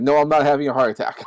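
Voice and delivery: ordinary talk, nothing marked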